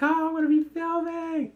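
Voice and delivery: thrilled to finally get on camera; giddy imitation of the actress; happy. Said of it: "she's all happy".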